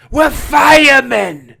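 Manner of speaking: slow, high, shouted firemen cry; Teddy Atlas shout. Retold or imitated. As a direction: roar it like a fired-up trainer. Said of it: "that was more Teddy Atlas".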